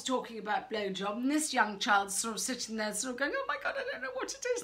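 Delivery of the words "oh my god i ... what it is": fast, high, laughing; mock panicked kid. Clueless child among laughing classmates, high and fast.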